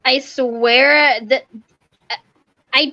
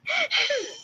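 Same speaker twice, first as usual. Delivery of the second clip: short laugh; 8 dB quieter than usual. Real, not acted. Laughs after joking "I'm kidding.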